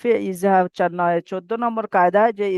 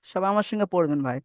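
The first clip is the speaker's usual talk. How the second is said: normal talking voice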